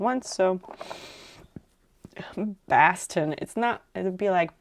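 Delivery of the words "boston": "Boston" said with a flat A, like "Bastən". Shown as a demonstration. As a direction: say "Boston" turning the O into an A. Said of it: "the O doesn't become an A".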